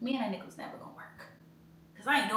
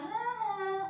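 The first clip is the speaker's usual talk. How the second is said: mock taunt; 12 semitones higher than usual. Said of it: "I was like".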